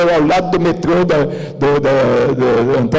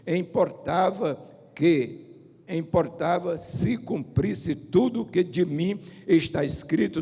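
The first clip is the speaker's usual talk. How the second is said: measured, softer solemn reading; scripture reading; 5 dB quieter, slower than usual. Acted. Preacher reads Jesus' words to the congregation, solemnly. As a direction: read the verse out with deliberate phrasing.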